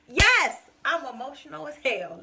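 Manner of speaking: a sudden loud, high yes, then a firm claim; proud, loud admission; defiant shout. Real, not acted. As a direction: belt it back at the critics. Defiant reply to critics.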